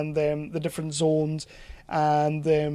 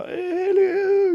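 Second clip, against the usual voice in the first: put-on high sing-song little old lady hello; high wavering granny greeting; Annette Crosby hello. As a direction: coo it like a sweet doddery old lady. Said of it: "doing, like, the Annette Crosby thing".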